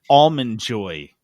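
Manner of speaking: usual speaking voice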